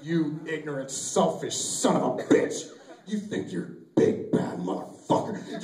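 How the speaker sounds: slow, low, seething insult; strained, hammed-up scolding; mock angry yelling. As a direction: chew out your own body, drawn out. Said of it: "I'm just yelling at my penis".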